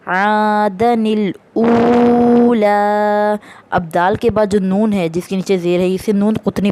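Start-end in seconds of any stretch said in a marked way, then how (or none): 0.1-3.3 very slow, drawn-out Quranic recitation. Shown as a demonstration. Teacher reading the first example to students, slow drawn recitation. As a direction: stretch every vowel as in tajweed. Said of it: "उन्होंने हमें पहली मिसाल दी है".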